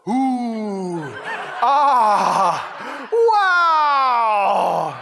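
long, falling exclamations of amazement; awed oohs and aahs. Acted. Preacher imitating everyone beholding new glory, awestruck. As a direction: stretch each one out in hammed-up wonder. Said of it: "all of us will go".

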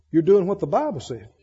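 normal talking voice

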